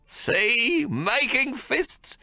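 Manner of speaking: put-on high, slow, delighted old man; gleeful. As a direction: crow over the baby's fists. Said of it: "David says gleefully".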